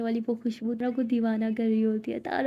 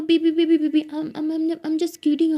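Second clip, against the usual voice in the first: stammering plea; 5 semitones higher, 4 dB louder, slower than usual. Acted. Siya pleads Tara not to leave.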